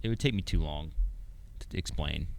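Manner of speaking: soft whispered reply. Real, not acted. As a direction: breathe it out quietly.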